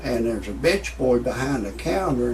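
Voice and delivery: ordinary talk, nothing marked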